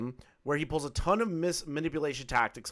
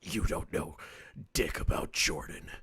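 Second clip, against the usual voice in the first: whispered low Batman impression; 9 semitones lower, 5 dB quieter than usual. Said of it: "the Batman villain voice".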